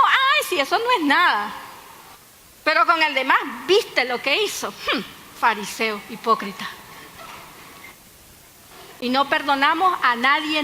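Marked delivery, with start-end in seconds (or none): 0.1-1.5 mock breezy excuse. Mocking self-indulgent attitude. 3.7-6.7 mock judgmental sneer. Mocking judgmental attitude.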